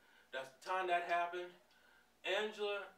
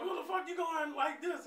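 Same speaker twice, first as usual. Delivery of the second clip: much higher, louder mock yell; retold yell; 11 semitones higher, 4 dB louder than usual. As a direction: Angela shouting at her man as he leaves. Angela yelling at her man.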